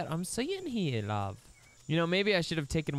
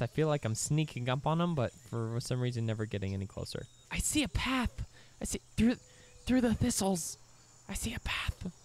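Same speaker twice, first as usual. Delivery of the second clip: normal talking voice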